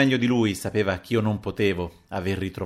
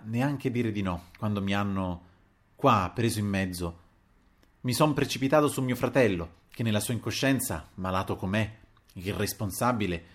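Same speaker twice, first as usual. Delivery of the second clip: usual speaking voice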